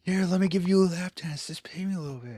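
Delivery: coaxing dancer. Acted. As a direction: imitate a dancer wheedling for business. Voices the dancer's pleading offer.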